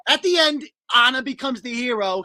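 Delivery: normal talking voice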